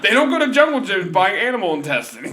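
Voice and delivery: high, loud exasperated rant; retold heated rant; incredulous rant. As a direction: lay into her in disbelief. Ranting at the vegan customer.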